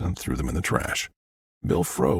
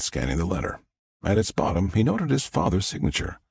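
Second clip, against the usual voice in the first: ordinary delivery, nothing marked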